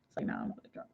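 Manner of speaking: whispered tipsy aside. Real, not acted. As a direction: whisper it under your breath.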